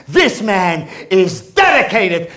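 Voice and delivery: normal talking voice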